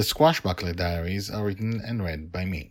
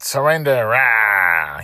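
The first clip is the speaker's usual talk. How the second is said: pirate bellow; 3 semitones higher, 10 dB louder, slower than usual. Acted. Pirate captain bellows at the little girl, loud. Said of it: "he ended with his familiar bellow".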